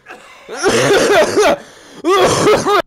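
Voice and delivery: high, loud burst of coughing laughter; loud laughing cough. Real, not acted. Sudden loud noise while playing.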